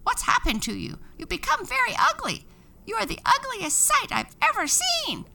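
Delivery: high, loud, fast parrot screech; shrill terrified bird voice. A narrator's reading. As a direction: squawk in panic at the ugly sight. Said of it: "screeched in terror".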